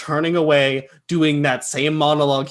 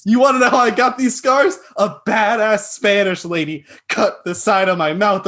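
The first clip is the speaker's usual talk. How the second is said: higher, louder villain impression; Joker; 6 semitones higher, 4 dB louder than usual. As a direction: play a hammed-up menacing movie villain. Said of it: "Joker style".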